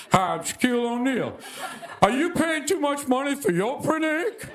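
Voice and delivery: lightly voiced infomercial pitch; a hint of a TV ad read; mock Shaq pitchman. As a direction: sell printer ink like a celebrity ad. Shaq ad parody.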